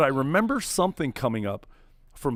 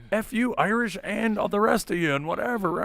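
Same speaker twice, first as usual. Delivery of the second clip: higher-pitched mock outburst; retold defiance; 8 semitones higher than usual. Tipsy Scottish friend dismissing the others, mock defiant. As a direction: imitate a friend cursing off the whole group. Acting out friend cursing everyone off.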